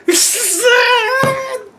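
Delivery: high, shrill burst of laughter; shrieking laugh. Real, not acted. Vlogger breaks into a high shriek of laughter. Big laugh at own joke.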